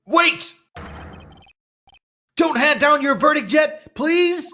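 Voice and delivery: urgent objection. Acted. A character interrupts the judge with a frantic high plea. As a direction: stop the verdict with a frantic plea.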